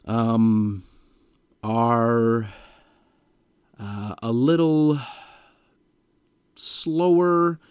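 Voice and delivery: halting, drawn-out demo with long um pauses; very slow talker. Shown as a demonstration. Salesperson demoing an extremely slow talker's pace. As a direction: drag every word out painfully. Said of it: "talks extremely low like that".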